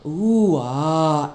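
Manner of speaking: mock oohing and aahing onlookers; awestruck crowd. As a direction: imitate a crowd's drawn-out ooh-ahh. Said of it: "everybody's like".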